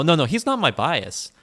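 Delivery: ordinary talk, nothing marked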